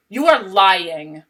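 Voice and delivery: each word spaced out and pressed hard; firm, deliberate call-out of lying coaches; slow emphatic accusation. Real, not acted. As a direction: land it slowly, like a verdict. Calling out dishonest coaches.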